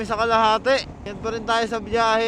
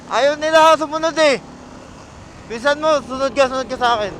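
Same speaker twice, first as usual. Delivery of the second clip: calling out; 5 semitones higher, 7 dB louder than usual. Real, not acted. Calling riders to follow him.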